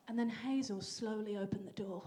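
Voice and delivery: ordinary delivery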